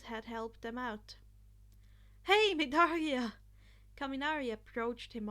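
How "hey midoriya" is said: loud call across the room. A narrator's reading. Kaminari calls Midoriya after the exam, loud. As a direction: call his name to catch him.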